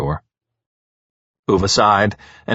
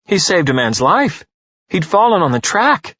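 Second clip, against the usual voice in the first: a mild, raised call through a door; calling out, pitched higher and louder; yelled; 9 semitones higher, 3 dB louder than usual. A narrator's reading. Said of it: "yelled the garage door".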